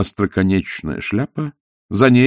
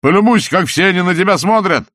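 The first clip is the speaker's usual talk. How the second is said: taunting; 9 semitones higher, 4 dB louder than usual. Acted. Salzella taunting Walter.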